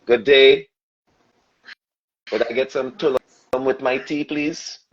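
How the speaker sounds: careful, polite Trinidadian accent for greetings; put-on Caribbean accent. Shown as a demonstration. American showing how he had to talk to survive, polite Caribbean accent. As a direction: demonstrate a learned Trini accent, very courteous. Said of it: "had to learn to talk like this".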